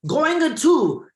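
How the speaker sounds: put-on high, loud Chinese-accented teacher correcting; Chinese-accented teacher insisting on gonna; teacher imitation. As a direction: drill the student on saying it right. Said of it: "She said".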